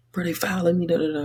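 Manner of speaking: high, whiny imitation of a frustrated friend; mock-whiny teammate moaning about fouls; friend's complaint. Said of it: "he'd just be like".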